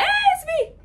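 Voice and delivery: very high, loud, giddy hello; high excited greeting. Pretend. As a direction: squeal it like a smitten girl. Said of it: "I would have been like".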